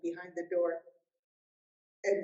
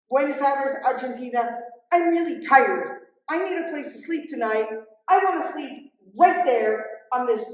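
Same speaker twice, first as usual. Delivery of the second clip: much higher and much louder, worn-out angry pilgrim; angry outburst; 5 semitones higher, 10 dB louder than usual. Retold or imitated. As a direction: snap out the demands, tired and fed up. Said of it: "I was short with you".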